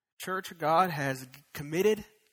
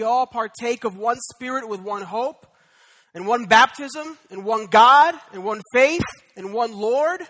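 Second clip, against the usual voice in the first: each "one" hit harder, much louder toward the end; rising preaching; 3 semitones higher, 11 dB louder than usual. Acted. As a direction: build the list up like a preacher. Sermon climax on unity.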